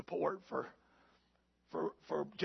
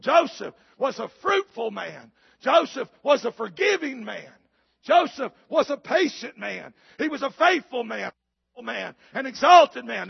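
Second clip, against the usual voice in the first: loud, higher rhythmic refrain; preaching at full force; 5 semitones higher, 10 dB louder than usual. Acted. Preacher summing up Joseph's virtues to the church, loud and rhythmic. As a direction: hammer each line of the list.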